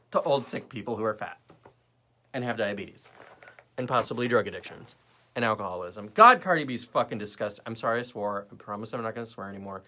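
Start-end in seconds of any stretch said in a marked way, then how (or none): none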